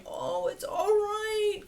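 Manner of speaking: high, slow, fretful cooing; worried reassurance. Shown as a demonstration. Trainer mocking an anxious owner's fretful high coo. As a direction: sound anxious while soothing. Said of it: "'cause if you sound worried".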